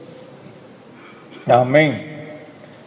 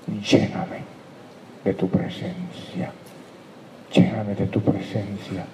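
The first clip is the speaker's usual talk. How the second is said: soft, lower, fading to a breathy hush; humble tearful prayer; 3 semitones lower, 6 dB quieter than usual. Shown as a demonstration. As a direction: pray it broken and contrite, almost whispered at the end. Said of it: "cuando usted va a llorar".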